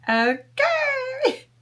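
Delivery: a very high rising "Okay" fighting back tears; high wobbly mock okay; squeaky okay. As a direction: squeak it out, barely holding it together. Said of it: "And I was like".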